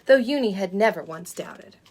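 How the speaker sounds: put-on lively read-aloud for small children; storybook reading. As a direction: read it like a bedtime picture book. Reads a picture book to children.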